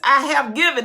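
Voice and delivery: loud, ringing voice of God; thunderous pulpit declaration; booming proclamation. Acted. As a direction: announce it with full power. Preaching at full force.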